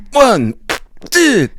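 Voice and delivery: very high, strained counting; French accent. Retold or imitated. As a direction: strain out each word in French. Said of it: "He's like".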